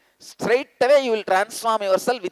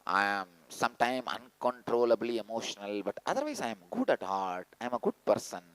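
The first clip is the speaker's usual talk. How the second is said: much lower and softer, muttered self-excuse; mock excuse; 9 semitones lower, 11 dB quieter than usual. Mocking the fool's excuse to the audience, low muttered. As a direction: mumble it like a lame alibi. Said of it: "all your theories you have".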